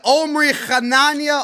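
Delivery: "Hanania" with a throaty Hebrew kh, like "Khanania"; hard guttural H. Shown as a demonstration. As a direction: rasp the first H of "Hanania" in the throat. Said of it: "that hard sound when you say my name".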